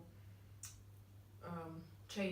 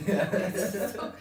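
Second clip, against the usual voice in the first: laughing; 12 dB louder than usual. Real, not acted. Laughing wrap-up.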